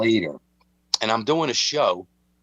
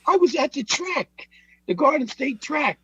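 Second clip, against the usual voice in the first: much higher, put-on voice of an indignant old gangster; old Pete's voice. Telling Mike about his father, indignant high old-man voice. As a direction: play Pete, outraged he laughs. Voicing old Pete, retold.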